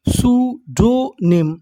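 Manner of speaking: सूडोनिम slowly, split as सू, डो, निम; slow teaching breakdown of सूडोनिम; syllable by syllable. Shown as a demonstration. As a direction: give each part of सूडोनिम its own beat. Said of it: "तो हमारी meaning क्या बनी".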